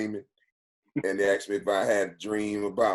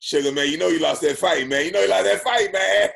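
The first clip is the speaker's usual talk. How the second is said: raised, higher teasing jab; mock teasing call-out; 10 semitones higher, 7 dB louder than usual. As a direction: bust out with it, needling him loud and high. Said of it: "then Bernie bust out".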